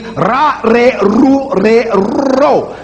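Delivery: ra, re, ru, ro rolled at the tongue tip; trilled R demo. Shown as a demonstration. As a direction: trill each R. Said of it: "that apical alveolar trill".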